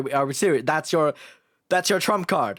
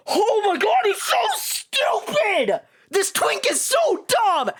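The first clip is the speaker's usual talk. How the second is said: exasperated shout; 10 semitones higher than usual. Real, not acted. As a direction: yell at the screen in disbelief. Exasperated outburst at dumb character.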